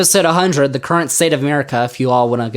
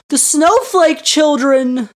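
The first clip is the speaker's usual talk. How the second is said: put-on "snowflake children" much higher and slow, sarcastic; mock pity; 11 semitones higher, slower than usual. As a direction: stretch "snowflake" in fake concern.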